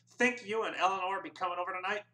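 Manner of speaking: high, fast lightly voiced registrar; registrar, friendly. A narrator's reading. Registrar invites his clerk, lightly voiced. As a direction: chat with your buddy. Sam chats with Charlie.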